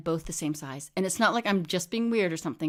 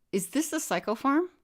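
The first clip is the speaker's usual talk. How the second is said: high-pitched, rising query; puzzled question; 8 semitones higher than usual. A narrator's reading. Patient asking the nurse about the psycho farm, high puzzled question. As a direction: ask it high, unsure you are in the right place. Narrator asks nurse in story.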